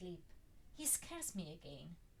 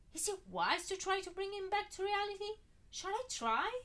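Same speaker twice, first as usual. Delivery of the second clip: anxious; 13 semitones higher, 8 dB louder than usual. Acted. Frightened self questioning.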